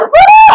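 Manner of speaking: high, shouted "woo"; whoop. Real, not acted. Trainer feeling the burn herself, high whoop.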